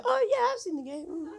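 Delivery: very high, thin-sounding fib; high-pitched bluff. Retold or imitated. Bluffing to a new friend at a work dinner, high-pitched.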